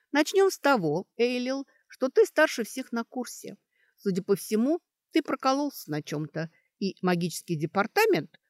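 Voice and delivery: brisk Sashka reasoning aloud. Acted. Sashka sets out her deductions to the elf, emphatically. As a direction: lay out the case point by point, with force.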